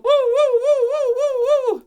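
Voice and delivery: high, loud wailing siren noise; siren imitation. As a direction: wail like a police car arriving.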